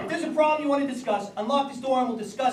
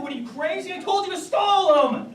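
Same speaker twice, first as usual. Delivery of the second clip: usual speaking voice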